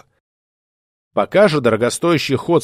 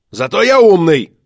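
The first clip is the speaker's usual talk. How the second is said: loud, higher snarl; snarling retort; 5 semitones higher, 5 dB louder than usual. Acted. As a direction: snap it back, teeth bared. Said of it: "ощерился Уйбуй".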